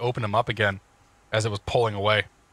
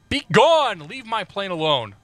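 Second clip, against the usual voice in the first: high, louder shout of Be gone, then settling; hammed-up command to leave the plane alone; mock dramatic banishing; 7 semitones higher, 3 dB louder, slower than usual. Pilot shooing ground crew away, hammed-up shout.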